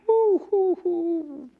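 falsetto "whoo" at a stench; high hooting whoops. Acted. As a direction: hoot high and loud in mock disgust. Reacting to the stench of Lazarus.

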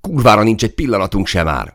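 high, agitated snapping back; tense retort. A narrator's reading. Soldier out of time to the gunner, agitated. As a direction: answer sharply, voice pushed up. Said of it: "válaszolt idegesen Máté".

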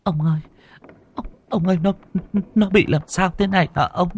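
high, frightened pleading; wife asking what is wrong with their daughter; trembling wife. A narrator's reading. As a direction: beg your husband, shaking with fear. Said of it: "bà run cầm cập".

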